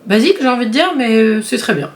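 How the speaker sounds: usual speaking voice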